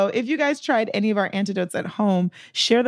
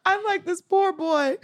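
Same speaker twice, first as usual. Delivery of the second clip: high, giggly mock pity; amused sympathy, laughing high; laughing disbelief; 12 semitones higher, much slower than usual. Real, not acted. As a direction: laugh through a pitying whoa. Laughing reaction to memoir.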